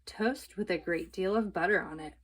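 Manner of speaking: ordinary delivery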